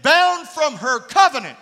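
loud preaching shout on bound; shouted emphasis. Real, not acted. Preacher to congregation, shouting. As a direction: hammer the phrase home to the church.

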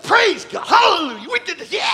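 loud, high hammed-up hallelujah; triumphant shout. Pastor celebrating after praying for a baby, mock triumphant shout. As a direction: shout victory after the prayer. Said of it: "When I got done, I'm like".

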